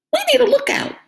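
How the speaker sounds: very high, loud duck call; duck bark. A narrator's reading. Duck calls for a lookout. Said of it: "Duck barked".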